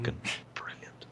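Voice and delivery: sarcastic under-the-breath whisper. Real, not acted. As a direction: whisper it dryly to yourself. Sarcastic aside after hate mail.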